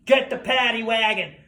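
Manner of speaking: imitated cop calling for backup; officer on walkie-talkie. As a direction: call it in urgently as police. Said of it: "leaned into his walkie-talkie and went".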